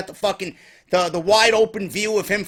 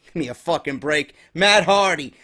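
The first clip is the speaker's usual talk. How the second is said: ordinary talk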